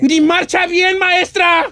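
put-on shouting kid. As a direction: yell it up at the teacher like a pupil. Kids shouting to the teacher.

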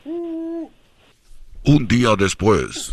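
very slow, low dramatic announcement; slow narrator voice. Acted. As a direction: stretch it out like a movie title card. Narrator time skip card. (voz de narrador lenta y grave)

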